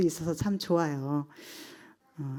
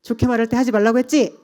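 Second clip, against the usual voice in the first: fast, loud, higher-pitched mock scolding; imitated scolding; 4 semitones higher, 7 dB louder, faster than usual. Mother pressing her whining child, imitated scolding. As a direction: rattle it off like a mother at her limit. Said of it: "이렇게 다그치는 거예요".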